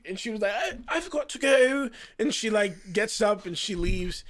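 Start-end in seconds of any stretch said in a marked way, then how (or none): 0.5-1.9 high, louder mock British exit line; British accent. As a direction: imitate her exaggerated fake British, pitched way up. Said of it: "she got nervous. She was drunk".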